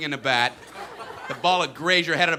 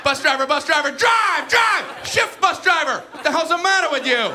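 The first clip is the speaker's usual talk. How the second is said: loud, very high frantic shouting; hollered heckling; 10 semitones higher, 6 dB louder than usual. Acted. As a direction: badger the driver at the top of your voice. Yelling chatter at bus driver.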